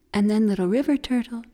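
ordinary delivery

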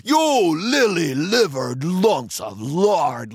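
hammed-up pirate scolding; lashing out. A narrator's reading. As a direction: berate your cowardly crew. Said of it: "lashed out Dirty Joe".

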